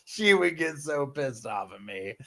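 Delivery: laughing. Real, not acted. Laughing recalling scaring her sister.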